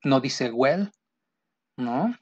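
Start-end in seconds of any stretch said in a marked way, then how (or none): 0.5-0.9 "well" with a clear Spanish E; wrong full-E vowel. Shown as a demonstration. Teacher warning how not to say will, full E as in "well". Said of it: "No es una E".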